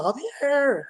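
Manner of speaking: high-pitched retold yell of a name; alarmed yell. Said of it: "I hear her yell".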